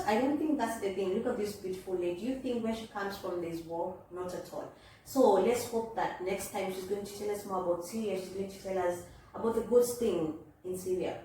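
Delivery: normal talking voice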